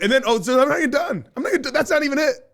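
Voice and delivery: can't wait to tell the next part; excited. Real, not acted. Host to cohost, eager to continue the food story, excited.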